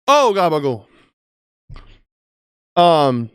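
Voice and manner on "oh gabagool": loud mock New York Italian; hammed-up New York accent, high start falling. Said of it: "I was doing my New York accent".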